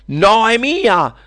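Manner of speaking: high, loud, stretched-out protest; bawling. Acted. Witch of discord refuses to give up sphere, bawling. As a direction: bawl it slowly like a possessive child. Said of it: "sbraitava la strega della discordia".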